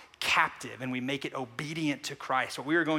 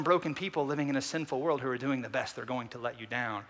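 ordinary delivery

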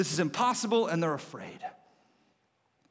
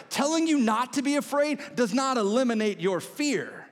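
ordinary delivery

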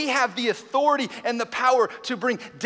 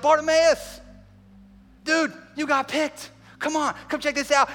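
excited calling out; 5 semitones higher than usual. Acted. Crowd telling the blind man he's picked, excited calling. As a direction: call him over, thrilled for him.

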